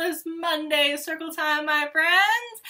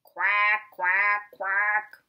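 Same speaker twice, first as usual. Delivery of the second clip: duck quack; 6 semitones lower, much slower than usual. Acted. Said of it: "And what does a duck say?".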